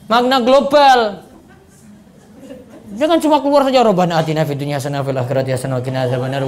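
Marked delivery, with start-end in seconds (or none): 3.8-6.5 rattled off fast and low like a habit; rushed rote prayer. Shown as a demonstration. Ustaz mocking a mindless prayer, rushed fast and low. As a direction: gabble the prayer without thinking.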